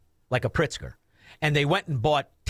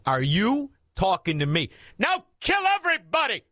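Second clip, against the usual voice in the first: "Are you talking to me?" then a high shout of "kill everybody"; mock tough guy rallying to attack; tough-guy challenge rising to a yell; 4 semitones higher than usual. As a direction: build from a dare to a high yell. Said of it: "everybody with an I Q over sixty says".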